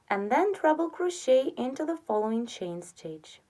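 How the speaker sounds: normal talking voice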